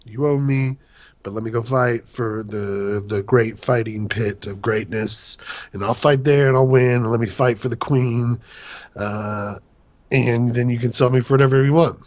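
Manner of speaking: lightly voiced low pleading knight; a hint of a gruff, earnest soldier; Jorah voice. Jorah pleading his case, retold.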